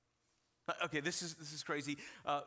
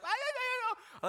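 high mock wail of a child; loud, garbled squealing cry; imitated crying; 4 dB louder than usual. Son bursts in to dad, imitated high crying. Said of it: "he is crying and he's like".

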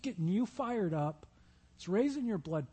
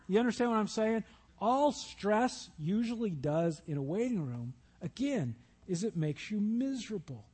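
usual speaking voice

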